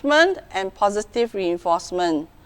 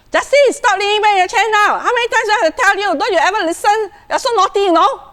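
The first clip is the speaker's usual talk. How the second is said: high, loud, fast scolding; mother yelling at her son for rocking the chair, hostile; 12 semitones higher, 6 dB louder, faster than usual. Shown as a demonstration. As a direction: shout the child down, exasperated. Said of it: "is hostile".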